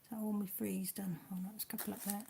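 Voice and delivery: whispered to herself; under her breath, unvoiced; whispering. Real, not acted. Muttering to herself over puzzle.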